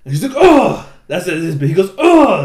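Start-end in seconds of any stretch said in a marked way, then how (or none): 0.4-0.9 high, very loud "oh"; character's signature cry. Retold or imitated. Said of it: "That's his, like, character". 2.0-2.4 high, very loud "oh"; character's signature cry. Retold or imitated. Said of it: "That's his, like, character".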